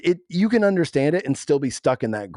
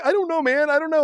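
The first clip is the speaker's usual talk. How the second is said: much higher, panicked mock voice; panicky buddy. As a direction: freak out in a squeaky put-on voice. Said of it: "And he's like ... he's freaking out".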